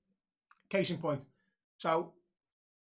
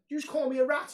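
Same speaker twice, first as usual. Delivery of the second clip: higher, louder challenge; a player confronting a fan's dad; angry accusation; 9 semitones higher, 7 dB louder than usual. Retold or imitated. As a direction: fire the question back at him. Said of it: "let round, went".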